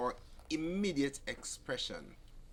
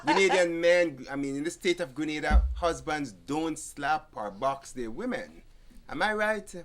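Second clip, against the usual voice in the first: emphatic joke; 2 semitones higher, 10 dB louder than usual. Real, not acted. Pastor joking loudly to the audience.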